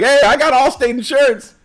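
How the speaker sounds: high, loud, excited heckle; excited fan shout. Acted. People spotting a celebrity and calling out, excited.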